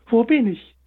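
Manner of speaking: bewildered hare. A narrator's reading. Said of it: "schaute sich verwundert um".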